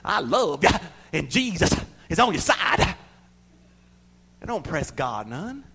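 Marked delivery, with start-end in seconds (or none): 0.1-2.9 mock quivery high prayer. Preacher mocking a quivery prayer voice, lightly. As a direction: wobble it like a showy pray-er. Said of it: "with a quivery voice".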